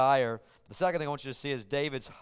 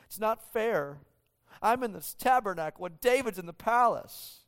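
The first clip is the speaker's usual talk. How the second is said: higher, lightly put-on sulky voice; mock petulant complaint; 6 semitones higher than usual. Preacher parodying a jealous God, higher and sulky. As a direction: whine it like a left-out child, tongue in cheek. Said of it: "God's not saying that".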